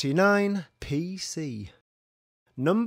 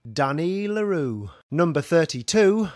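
normal talking voice

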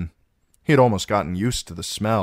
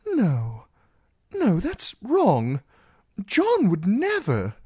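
protest; 5 dB louder, slower than usual. A narrator's reading. Sam protesting loudly.

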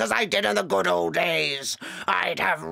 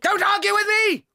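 angry shout; 9 semitones higher than usual. Acted. Headmistress scolding teacher.

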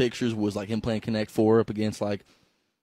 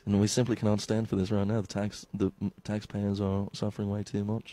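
low, soft, solemn statesman act; mock-serious policy speech; mock politician; 4 semitones lower, 6 dB quieter than usual. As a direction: play a grave politician addressing the crisis. Mock stuffy political debate voice.

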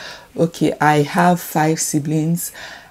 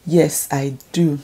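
normal talking voice

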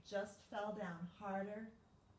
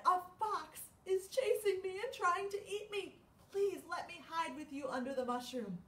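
high, loud frightened plea; scared bunny; 9 semitones higher, 4 dB louder than usual. Acted. Bunny begs to hide from the fox, frightened. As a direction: plead for shelter from the fox. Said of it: "he said".

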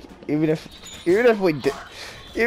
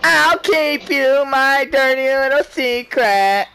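slow teasing sing-song; 6 semitones higher, slower than usual. Pretend. Seductive teasing slow line.

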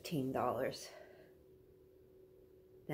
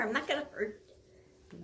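coaxing dog voice; 10 semitones higher, faster than usual. Real, not acted.

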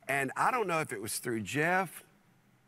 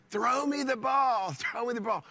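excited calling out; 8 semitones higher than usual. Retold or imitated. Fan jumping up and calling to Nolan Ryan, excited high call. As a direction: call out high and long, begging for the throw.

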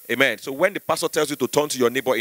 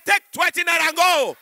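high, brisk pastor voice; pastor bargaining; 14 semitones higher than usual. Retold or imitated. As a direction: pay the demon off. Pastor replies.

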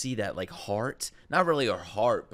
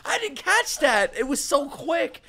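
high, rushed shock that fades; a viewer reeling after a quick kill; stunned outburst; 13 semitones higher, 4 dB louder than usual. Real, not acted. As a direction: cry out that it was too fast. Reacting to the stabbing.

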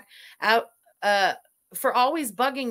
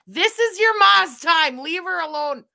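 1 mock stern shout; 6 semitones higher, 8 dB louder than usual. Scolding viewer's son Zach.

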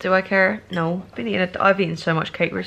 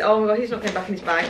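usual speaking voice

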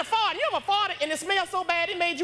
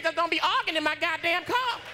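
ordinary delivery

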